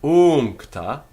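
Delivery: "Uncta" with a high, loud, held u; long U. Shown as a demonstration. Teacher showing a long vowel, "Uncta" with drawn-out u. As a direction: stretch the first vowel of "Uncta". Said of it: "has got a long u".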